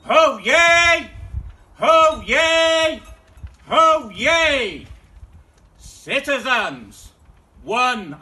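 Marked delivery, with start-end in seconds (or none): 0.1-4.9 loud ringing proclamation call; town crier call. Acted. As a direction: bellow it across the square.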